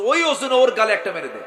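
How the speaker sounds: loud commanding order; stern order. Acted. Caliph ordering the commoner to slap the king. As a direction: command him to strike back.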